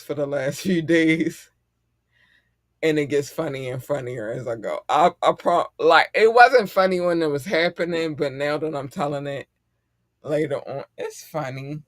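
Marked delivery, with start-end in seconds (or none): none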